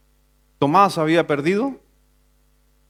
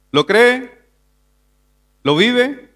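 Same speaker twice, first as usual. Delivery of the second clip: imitating a preacher's higher, rising challenge; preacher's catchphrase; 8 semitones higher, 5 dB louder, much slower than usual. Quoting a preacher he used to hear, preacher's rising challenge. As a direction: throw out each short question like a preacher, pitched up. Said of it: "un predicador que siempre decía eso".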